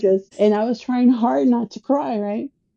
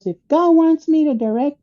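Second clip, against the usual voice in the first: retold prideful retort, pitched up; proud defiance; 7 semitones higher, 4 dB louder than usual. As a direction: lay down a proud challenge to your dad. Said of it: "this is me and my pride".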